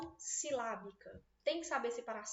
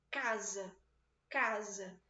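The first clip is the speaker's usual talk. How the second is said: CA of Casa said louder, za dropping away; Casa with a strong, stretched first syllable; stress on first syllable of Casa; 3 semitones lower, 4 dB louder, much slower than usual. Shown as a demonstration. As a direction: punch the CA in Casa, let the -sa fall. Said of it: "ele é muito mais alto do que o S A".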